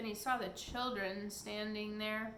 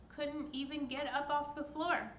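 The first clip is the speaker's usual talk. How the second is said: usual speaking voice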